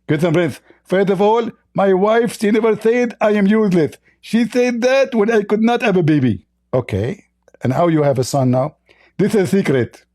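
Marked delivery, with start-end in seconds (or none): 0.1-6.5 high-pitched, lisping mock debater; Zakir Naik. Host mimicking his debate opponent, high lisping impression. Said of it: "Hey, Zakir Naik". 9.2-10.0 high-pitched, lisping mock debater; Zakir Naik. Host mimicking his debate opponent, high lisping impression. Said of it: "Hey, Zakir Naik".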